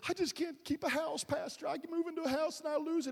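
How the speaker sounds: high-pitched helpless complaint; put-on singsong grumble; mock whining. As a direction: imitate a whiny congregant moaning to the pastor. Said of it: "It's like the person".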